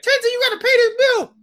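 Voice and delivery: loud, very high-pitched holler; mock yelled demand. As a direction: imitate a bill collector hollering in a high voice. Acts out someone demanding bill payment.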